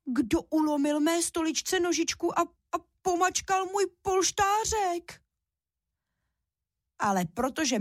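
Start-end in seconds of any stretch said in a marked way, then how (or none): 0.0-5.2 high little bear cub wail, slowing at the end; desperate squeak. A narrator's reading. Little bear finds his stool broken, desperate high squeak. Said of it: "Ten jenom zoufale vypískl".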